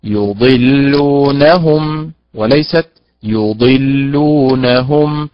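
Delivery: slow, careful stress demo; teacher demonstrating stress placement; correct vs wrong stress. Shown as a demonstration. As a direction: contrast the stress on يضلونهم slowly. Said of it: "فرق في المعنى بين هذا وذاك".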